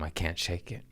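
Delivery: normal talking voice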